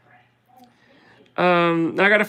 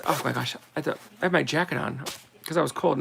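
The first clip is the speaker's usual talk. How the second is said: usual speaking voice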